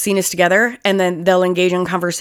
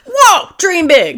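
loud, high, drawn-out movie quote; hammed-up, soaring exclamation; mock amazement; 10 semitones higher, 4 dB louder, much slower than usual. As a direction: belt it out slowly like a big film line. Quoting Juno movie with gusto.

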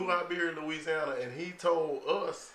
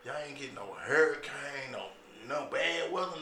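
ordinary talk, nothing marked

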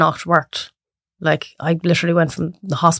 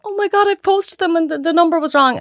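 high, panicked client's wail; 10 semitones higher than usual. Retold or imitated. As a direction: play the client who just spotted the mistake, high and flustered. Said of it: "And then you go".

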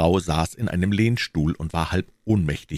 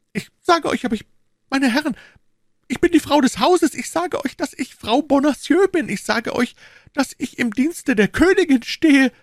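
very high, loud woman's protest; high-pitched, insistent pleading shout; distressed woman crying out; 5 dB louder than usual. A narrator's reading. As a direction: cry out desperately in a high voice. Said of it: "rief die unglückliche Frau".

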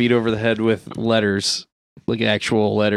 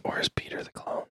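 hushed dramatic whisper; 13 dB quieter than usual. Acted. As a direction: whisper it like a secret reveal. Dramatic clone reveal.

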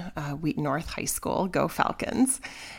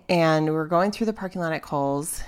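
ordinary talk, nothing marked

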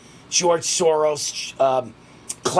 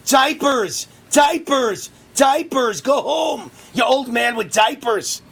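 imagined crowd yell; 7 semitones higher, slower than usual. Pretend. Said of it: "what I want them to scream?".

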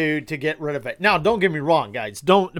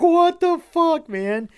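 much higher, louder, slow disbelieving cry; incredulous outburst; 14 semitones higher, 5 dB louder, slower than usual. Retold or imitated. Vlogger reacting to Biden, loud stunned disbelief. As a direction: blurt it out in shocked disbelief. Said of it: "And I'm like".

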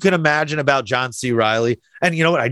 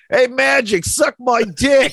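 hammed-up Boston heckle, slow on the last words; Boston accent; 6 semitones higher, slower than usual. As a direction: play a Boston girl taunting Magic in a Boston accent. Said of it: "in a Boston accent says".

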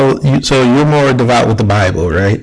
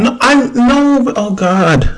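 exasperated; 13 semitones higher, much slower than usual. Real, not acted. Exasperated at partner's claim.

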